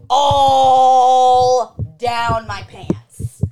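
drawn-out loud wail of dismay; anguished wail. Real, not acted. Coffee spilled into her pants, anguished slow loud wail. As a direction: wail it as the coffee soaks through.

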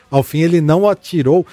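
normal talking voice

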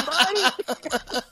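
normal talking voice

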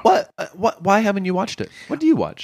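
high, incredulous questions; can't believe he hasn't watched it; surprised. Real, not acted. Disbelief at not watching.

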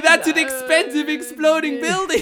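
put-on high, slow Yiddish-accented complaint.

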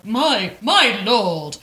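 high, loud hammed-up British toffs; mock British toffs. Fops babbling, hammed-up British. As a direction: babble posh British nonsense. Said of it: "they're all like".

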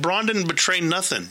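high, incredulous mock outrage at the screen; indignant protest. Fan yelling at the TV defending Bronn, high and incredulous. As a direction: squeak out the protest in disbelief. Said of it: "And I'm like".

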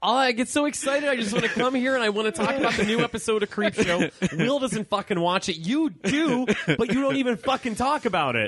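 high-pitched playful venting; mock frustrated rant. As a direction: whine about it, half joking. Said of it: "I'm mad".

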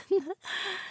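high tipsy giggle. Acted. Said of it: "She giggled".

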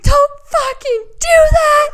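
high, loud, drawn-out wailing scold; hammed-up tearful outburst; bawling. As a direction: scold him through re-enacted sobs. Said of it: "And I'm bawling".

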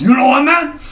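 a coworker losing patience; mock angry coworker. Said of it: "He goes".

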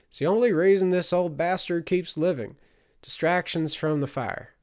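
high, loud woman's voice; exasperated middle-aged woman. A narrator's reading. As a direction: complain loudly about the old man.

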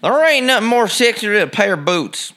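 lightly hammed-up Southern accent. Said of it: "a Southern accent".

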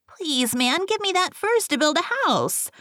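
very high piglet voice; pleading little pig. A narrator's reading. Second pig begs for furs.

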